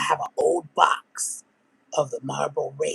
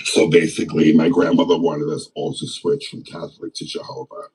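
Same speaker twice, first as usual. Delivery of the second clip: much lower, far-off imitation of the deep-voiced woman; 11 semitones lower, 5 dB louder than usual. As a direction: drop low into her raspy storytelling. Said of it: "talks like this".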